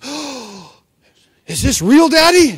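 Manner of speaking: high, rising, loud delighted gasp; a child who can't believe the gift; amazed girl. Retold or imitated. Girl amazed at the real pearl necklace her father gives her, high and loud.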